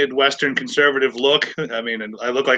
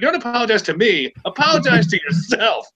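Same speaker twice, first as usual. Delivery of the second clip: retold scolding, pitched up; indignant rebuke; 8 semitones higher than usual. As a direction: hand the apology straight back, indignant. Retelling his rebuke to the man.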